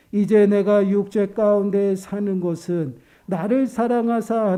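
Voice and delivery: slow, measured, even-pitched recitation; unison scripture reading. Real, not acted. Preacher leading the congregation in reading a verse, slow solemn recitation. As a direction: read the verse out slowly for all to follow. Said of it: "우리 한 목소리를 읽어보겠습니다".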